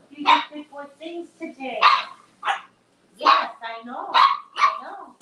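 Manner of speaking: high sing-song pet talk. Real, not acted. Owner praising her dog for being good, high sing-song pet voice. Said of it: "I have to praise him".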